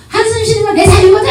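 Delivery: hammed-up indignant wife; defiant retort. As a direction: snap back in mock indignation. Wife snaps back at husband.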